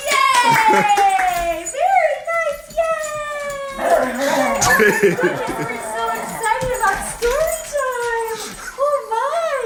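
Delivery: very high, loud delighted cheering; excited cheer. Real, not acted. Cheering after the song.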